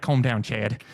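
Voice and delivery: Australian host settling a sidekick; calm him down in an Australian accent; dry put-on Australian reply; Steve Irwin. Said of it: "I'd be like".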